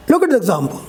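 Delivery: ordinary delivery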